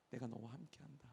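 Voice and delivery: ordinary talk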